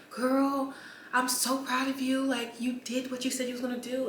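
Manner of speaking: a proud friend cheering her on; friend hyped up. Retold or imitated. Friend voiced proudly praising her.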